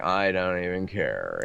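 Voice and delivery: slow, put-on aloof vocal fry. Mimicking aloof ad trends for the interviewer, in vocal fry. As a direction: drag it out in an indifferent creaky fry. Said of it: "with that vocal fry".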